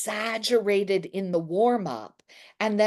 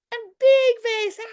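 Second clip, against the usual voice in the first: much higher and louder, opened wide; big loud face; 6 dB louder than usual. Shown as a demonstration. Teacher leading face warm-up, big loud high call. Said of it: "make your face as big".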